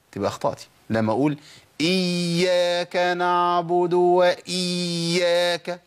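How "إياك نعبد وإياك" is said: chanted "إياك" with the ya stretched far too long, pitched higher; overdreven lange ya. Shown as a demonstration. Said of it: "لو زودتي زمن بقى الرخاوة".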